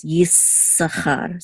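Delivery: "Yisachar" with a lengthened S; held S. Shown as a demonstration. Teacher shows how it's pronounced, "Yisachar" with S held. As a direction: dwell on the S of "Yisachar" before sachar. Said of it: "just hold it a little bit".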